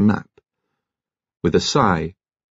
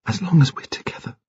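whispered tenderness; 6 semitones higher than usual. A narrator's reading. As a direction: whisper it close to a lover. Rose clinging, intimate exchange.

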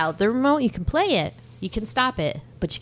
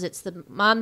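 ordinary talk

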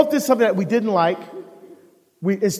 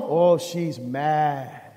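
low, slow, hushed warning; hushed aside; 6 semitones lower, 6 dB quieter, much slower than usual. Acted. Telling the audience his wife is upset, in a hushed, slow voice. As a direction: lean in and drop it low like a secret.

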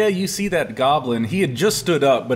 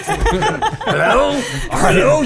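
goblin voice; 13 semitones higher, 4 dB louder, much slower than usual. Acted.

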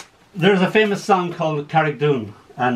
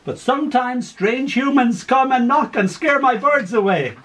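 put-on old gran voice; 9 semitones higher than usual. Confused old gran in care.

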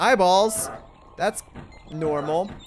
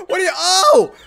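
ordinary talk, nothing marked

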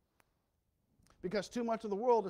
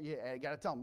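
ordinary talk

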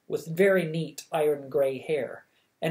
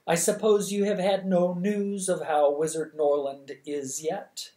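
ordinary delivery

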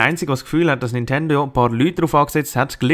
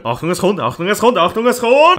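building excitement; 6 semitones higher than usual. Pretend. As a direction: build the warning up. Building tension.

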